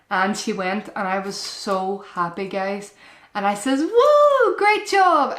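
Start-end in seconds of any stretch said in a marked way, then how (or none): 3.8-5.4 high, loud delighted woo; bursting with praise for a toddler; excited cheer. Retold or imitated. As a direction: cheer her on like she just scored. Mum cheering toddler on potty.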